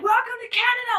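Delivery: high, sing-out holler; calling out. Retold or imitated. Re-enacting her shout into the silent room, high call. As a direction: call it out high over a crowd.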